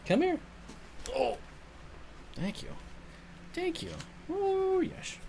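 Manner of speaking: high, soft you're so needy cooed at the dog. Real, not acted. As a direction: coo at the needy puppy. Baby talk to pet.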